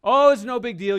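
high, loud, breezy dismissal; dismissive brush-off. Pretend. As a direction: wave it off like nothing happened. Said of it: "You don't say".

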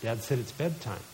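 low, hushed reminder; quiet fatherly firmness. Retold or imitated. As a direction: calmly lay down the bedtime rule. Dad reminds daughter of bedtime.

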